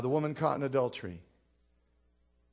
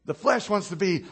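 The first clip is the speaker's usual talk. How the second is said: ordinary talk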